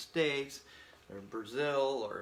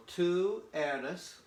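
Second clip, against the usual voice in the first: tú eres drawn out slowly, higher; slow model phrase; 5 semitones higher, 3 dB louder than usual. Shown as a demonstration. Teacher modelling tú eres slowly for the class. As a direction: spell it out for learners. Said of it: "makes it you".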